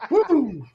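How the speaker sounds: high, loud woo; excited whoop. Real, not acted. Host reacting to a spicy episode, excited whoop.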